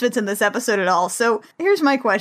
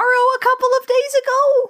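incredulous squeak; 13 semitones higher than usual. Real, not acted. Co-host questioning the history test's date, high incredulous squeak. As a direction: voice climbing very high in bafflement. Baffled by the story's timeline.

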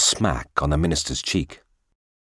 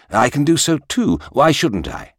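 higher, louder indignant retort; put out retort; 10 semitones higher, 5 dB louder than usual. A narrator's reading. King retorts to his minister, put out. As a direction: answer back, a little put out. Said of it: "The king was a little put out, and retorted".

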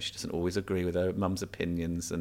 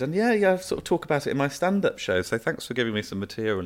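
cheeky reply; 8 semitones higher than usual. Retold or imitated. His own cheeky reply retold.

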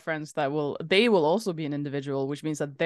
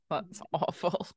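groaning disapproval; 8 dB quieter, slower than usual. Real, not acted. Groaning at a bad joke.

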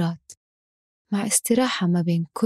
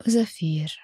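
slow, calm breathing cues; guided breathing; 4 dB quieter, slower than usual. Acted. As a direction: count the breath slowly and softly.

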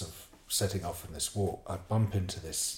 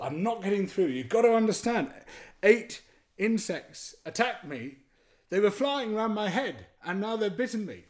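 desperate insistence; 12 semitones higher, 3 dB louder than usual. Retold or imitated. Stung man not getting through to villagers, acted desperate insistence. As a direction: hammer each phrase home to someone not understanding.

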